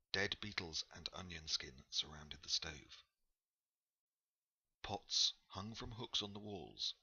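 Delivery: ordinary delivery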